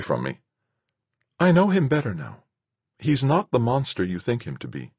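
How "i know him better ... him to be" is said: high, insistent woman's voice; woman defending him. Acted.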